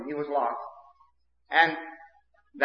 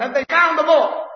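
much higher, loud, crying out; 11 semitones higher, 6 dB louder than usual. Retold or imitated. The towns crying out for the lost boy, high and loud. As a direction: call it out like the whole county asking. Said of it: "That was the cry".

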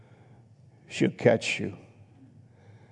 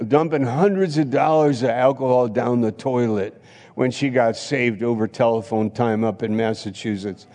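usual speaking voice

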